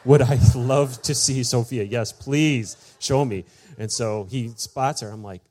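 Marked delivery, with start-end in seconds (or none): 0.1-3.4 drawn-out eager retold reply; super excited. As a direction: stretch it out with delight. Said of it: "I'm, like, super excited".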